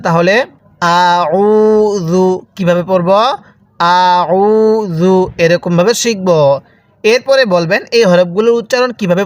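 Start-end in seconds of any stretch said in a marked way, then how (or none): 0.8-2.2 "আউযু" with unstretched আ and long held উ; short hamza, long vowel. Shown as a demonstration. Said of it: "হামজার মধ্যে কিন্তু টান দিবেন না". 3.8-5.2 "আউযু" with unstretched আ and long held উ; short hamza, long vowel. Shown as a demonstration. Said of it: "হামজার মধ্যে কিন্তু টান দিবেন না".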